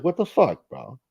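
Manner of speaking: high-pitched offended protest, dropping off; retold offended reaction. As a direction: act out being taken aback. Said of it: "That's why I was offended".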